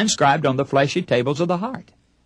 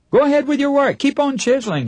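casual workman voice; 12 semitones higher than usual. Retold or imitated. Retold exchange with man chiseling.

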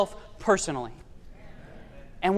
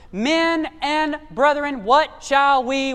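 slow dramatic plea; 3 semitones higher, much slower than usual. Acted.

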